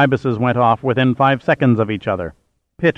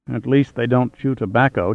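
plain reading voice

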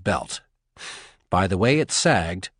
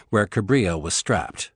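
usual speaking voice

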